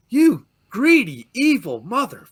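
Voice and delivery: angry outburst. Real, not acted. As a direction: spit it at the owners. Ranting at the club's owners.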